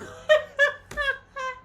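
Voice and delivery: real high-pitched burst of laughter. Real, not acted. Reactor cracking up over mixed company's fault, high laughter.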